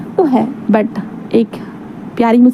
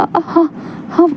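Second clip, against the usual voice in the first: higher, softer, slow stunned yes; stunned acceptance; 6 semitones higher, 4 dB quieter than usual. Acted. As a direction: gasp out the yes, overwhelmed. Stunned Wing accepts the proposal.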